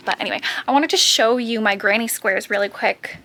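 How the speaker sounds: usual speaking voice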